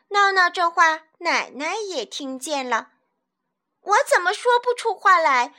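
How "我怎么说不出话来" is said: higher, louder protest; indignant outburst. Acted. Boy protesting to grandma, indignant loud. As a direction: fire back in disbelief.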